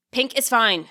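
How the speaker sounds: plain reading voice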